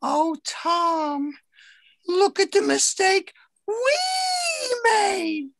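high sing-song boss voice stressing we; mock boss sharing blame. Boss turning her own mistake on Tom, stressing we. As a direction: lean on we to spread the blame.

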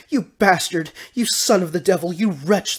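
high, loud string of insults; furious woman yelling; angry yell. A narrator's reading. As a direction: yell abuse down from the balcony. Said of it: "the plump blonde yelled".

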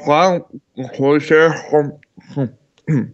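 talking with food in mouth; mouth full. Real, not acted. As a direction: exclaim through a mouthful. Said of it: "I shouldn't talk on my mouth full".

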